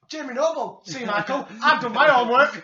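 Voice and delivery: mock commentator shout, high and loud; hammed-up commentary, booming towards the end; Taz. Host imitating the commentator on the match, loudly. Mimics Taz's excited commentary.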